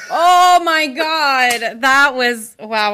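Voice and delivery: loud, drawn-out shocked gasp. Real, not acted. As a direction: stretch it out in shock. Shocked at forty episodes.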